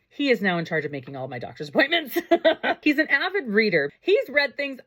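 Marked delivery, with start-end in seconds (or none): 2.2-2.8 quick high burst of laughter; short bright laugh. Real, not acted. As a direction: laugh out at her own story. Laughs after her story.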